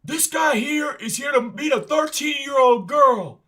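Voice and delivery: high loud slow public shout; announcing shout. Retold or imitated. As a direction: call it out to the whole store. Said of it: "the guy in the Target who's like".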